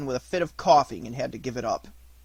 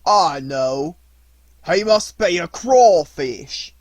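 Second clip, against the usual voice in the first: fisherman's voice; 3 semitones higher, 10 dB louder, much slower than usual. Acted.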